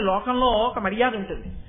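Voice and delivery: usual speaking voice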